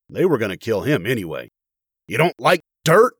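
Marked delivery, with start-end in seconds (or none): 2.1-3.2 raised, louder taunting yell; angry yell. A narrator's reading. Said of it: "he yelled".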